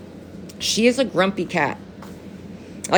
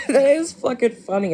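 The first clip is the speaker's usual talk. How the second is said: higher voice, talking through laughter; laughing; 5 semitones higher than usual. Real, not acted. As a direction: crack up while saying it. Cracking up at own joke.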